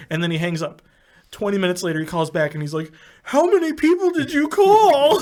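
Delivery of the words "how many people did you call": high, drawn-out, incredulous shout; mock outraged yell. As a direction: imitate a pranked friend's exasperated outcry. Retold outraged friend calling back.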